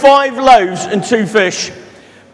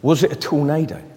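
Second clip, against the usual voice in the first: soft, low storytelling voice; reading a story to children, soft and low; story narration; 10 semitones lower, 5 dB quieter than usual. Acted. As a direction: draw the children in quietly. Reads a Bible story to children.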